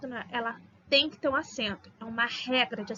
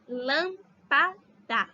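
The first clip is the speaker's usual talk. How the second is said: "lâmpada" very slowly, in three separate pieces; lâm-pa-da, syllable by syllable; separated syllables; much slower than usual. Shown as a demonstration. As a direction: split "lâmpada" as LÂM, pa, da with pauses. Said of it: "Se a gente separar".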